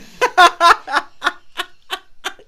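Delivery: loud, high burst of laughter; laughing. Real, not acted. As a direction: crack up loudly. Laughing at coming topic.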